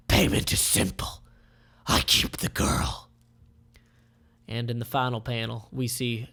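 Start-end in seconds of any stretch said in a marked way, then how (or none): 0.1-3.1 whispered sinister villain; menacing whisper. Acted.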